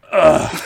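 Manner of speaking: loud contemptuous ugh; Patrice's disgusted groan. Retold or imitated. Patrice groans at Voss's joke, contemptuous groan. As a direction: grunt it like a fed-up mentor. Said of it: "He's like".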